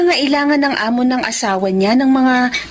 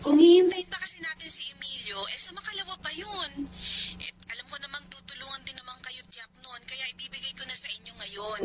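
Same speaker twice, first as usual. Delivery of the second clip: very faint, filtered phone speech; 14 dB quieter than usual. Acted. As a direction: explain from the far end of a call. Heard from the other phone end. (napakahinang boses sa kabilang linya)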